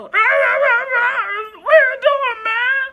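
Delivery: high, loud, slow stoned rabbit voice with a laugh; high, drawled, laughing puppet rabbit; Bobcat's bunny voice. Retold or imitated. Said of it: "That's how he would sound".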